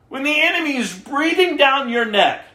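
ordinary talk, nothing marked

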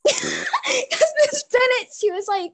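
laughing, stretched and high; words squeezed out through laughter. Real, not acted. Friend retelling the Big Daddy contact, laughing hard.

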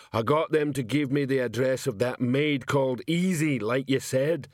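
thick, put-on lumbering voice; stoical Detritus. A narrator's reading. Detritus reports to Vimes on the maid, stoically. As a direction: report stolidly in a dull-witted constable's voice. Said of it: "said Detritus stoically".

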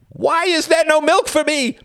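very high, loud slow scream. Acted. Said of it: "he screamed".

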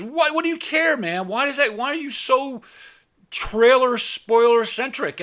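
high, loud mock angry rant; angry friend. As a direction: play the irritated friend snapping back. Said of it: "he gets really angry at me".

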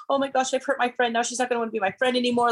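high, fast anxious inner voice; panicked inner voice. Retold or imitated. Her inner voice after hurting her friend, high and fast. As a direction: panic like a guilty kid. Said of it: "what do you think my heart was doing".

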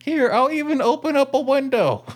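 high-pitched pretend mom, slowing at the end; mock-sweet mom voice; obliging mom. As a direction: play an all-too-accommodating mother up high.

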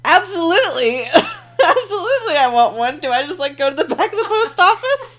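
high, loud eager reply; eager laughing yes. Retold or imitated. Retelling accepting a prison tattoo, eager laughing. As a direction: jump at the offer, laughing.

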